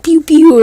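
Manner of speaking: high, loud "pew pew"; playful laser noises. Retold or imitated. Cheering up a crying friend after the fire, playful laser sounds.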